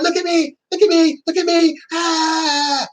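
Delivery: hammed-up high whine of an attention seeker; mocking whiny attention-seeker. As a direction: mimic a needy brat begging to be seen. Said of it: "they're going".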